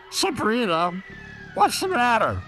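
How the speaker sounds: high, pushy question; demanding girl voice. Acted. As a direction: press her for an answer, up high. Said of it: "Carly Beth demanded".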